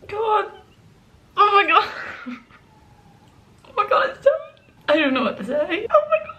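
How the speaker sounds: very high, tearful laughing cries; sobbing with relief and happiness; overwhelmed joy. Real, not acted. Student after submitting her degree work, crying with joy. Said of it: "My heart is beating so fast right now".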